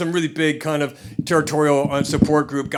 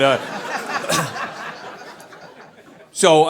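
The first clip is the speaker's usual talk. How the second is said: ordinary talk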